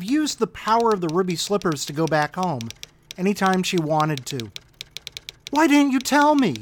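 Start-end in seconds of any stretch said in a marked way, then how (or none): 5.5-6.6 put-on high, whiny girl's complaint; whining. A narrator's reading. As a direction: whine it like a hurt child. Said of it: "whined Dorothy".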